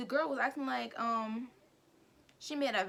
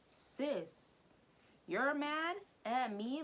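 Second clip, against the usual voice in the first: sassy taunt; 4 semitones higher, much slower than usual. Real, not acted.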